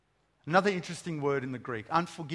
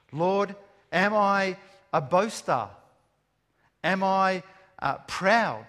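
louder, slow cry to God; pleading prayer; 2 semitones higher, 7 dB louder, slower than usual. Shown as a demonstration. Preacher praying aloud, asking God if he's proud, a loud plea. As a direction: call out the question to the Lord.

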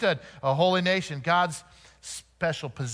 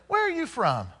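higher, rising puzzled asking; curious local; 4 semitones higher than usual. Retold or imitated. People in Portland asking the preacher, a light mock puzzled voice. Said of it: "people would say constantly".

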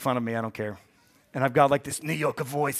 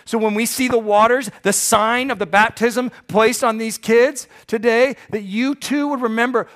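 loud, high, driving preacher's build; rising pulpit fervor; fervent preaching; 7 semitones higher, 8 dB louder than usual. Acted. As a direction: preach it out to the whole room. Preaching climax on baptism.

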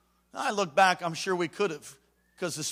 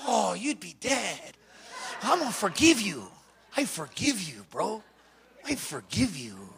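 grudging forgiveness; 5 dB quieter than usual. Retold or imitated. Gangbanger forgiving the new kid, straining for restraint. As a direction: force it out through held-back anger.

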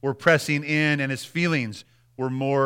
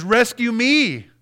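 pleading cry; 7 semitones higher, 6 dB louder, much slower than usual. Acted. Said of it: "He says".

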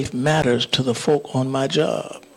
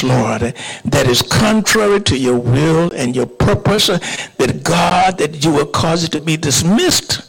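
impassioned pulpit prayer, swelling then settling; fervent prayer; 2 semitones higher, 3 dB louder than usual. Real, not acted. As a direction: plead with God on the congregation's behalf. Leads the congregation in prayer.

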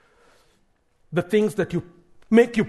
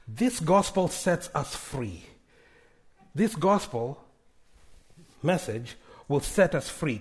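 slow emphasis; 2 semitones lower, 5 dB quieter than usual. Acted. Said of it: "slow emphatic preaching".

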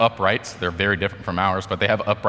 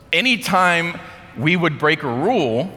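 normal talking voice

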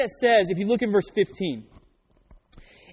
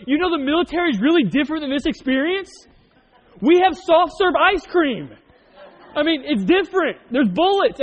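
incredulous retort; 8 semitones higher, 5 dB louder than usual. Retold or imitated. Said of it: "And I'm like".

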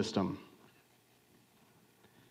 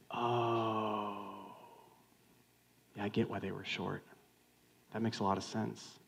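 softened understanding; 2 semitones lower, 8 dB quieter than usual. Acted. Friend hears the dad is dying, softly understanding.

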